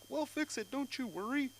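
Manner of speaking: very high, put-on sing-song; mock soothing falsetto; reassuring dental staffer. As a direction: brush off the patient sweetly.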